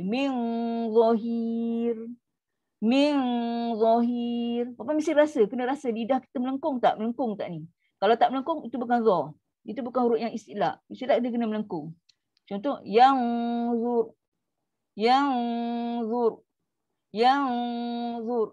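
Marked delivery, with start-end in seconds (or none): 0.0-4.7 slow recitation, rounded thick za in "zahir"; high thick za. Shown as a demonstration. Teacher shows the arched tongue lifting the sound, thick za. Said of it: "dia akan jadi tinggi". 12.8-18.5 "yang" held on a thick hum, then heavy "zur"; thick dengung. Shown as a demonstration. Teacher gives the example of a thick hum, thick dengung. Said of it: "dengung dia tebal".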